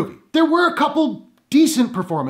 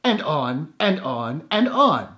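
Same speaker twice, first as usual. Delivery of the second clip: droning on; 5 semitones lower, much slower than usual. Acted.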